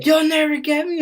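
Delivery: high, loud mock villain cry; hammed-up shout while being tackled; defiant fugitive. Acting out creepy man being arrested.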